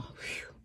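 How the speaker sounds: a breathless unvoiced whew; whispered breath out. Real, not acted. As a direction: blow out air to catch her breath. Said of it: "let me take a breath".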